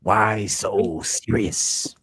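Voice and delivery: low, slow, soft Joker voice; a slow creepy whisper of a movie villain; Joker impression. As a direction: drag it out like the Joker. Quoting the Joker line.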